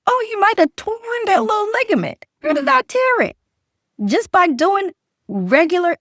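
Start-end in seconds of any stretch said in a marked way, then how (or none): 0.1-2.2 mock doctor voice. Mimics doctor's diagnosis. 2.4-3.4 mock confused patient. Mimics patient's confused question.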